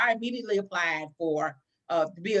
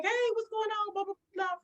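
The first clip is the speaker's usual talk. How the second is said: high-pitched, chatty mimicked hello; breezy greeting; 12 semitones higher, 3 dB quieter than usual. Secretary greets her visitor downstairs, breezy high greeting. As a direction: imitate a breezy, casual greeter.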